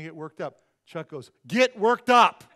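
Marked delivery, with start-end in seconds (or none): 1.5-2.4 much louder, higher punched yell; exasperated mock shout. Husband demanding his calm wife react, exasperated shout. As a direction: bellow it like a fed-up husband.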